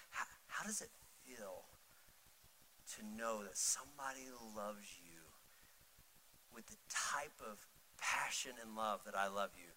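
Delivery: soft, halting romantic declaration; retold romantic earnestness. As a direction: pour out love tenderly, pausing between phrases. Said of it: "I thought I was being really romantic".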